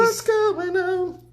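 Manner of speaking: very high, drawn-out silly voice; playful falsetto greeting. Pretend. Host greeting a viewer tuning in, silly high falsetto. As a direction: squeal the greeting slowly in mock falsetto.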